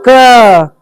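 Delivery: "क" drawn out with its full vowel; full KA sound. Shown as a demonstration. Teacher shows class the full letter, "क" drawn out. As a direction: hold "क" long and whole. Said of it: "क का पूरा उच्चारण".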